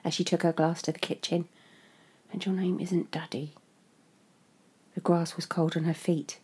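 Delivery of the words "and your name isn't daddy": soft bitter whisper. Acted. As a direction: whisper it to yourself. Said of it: "she muttered".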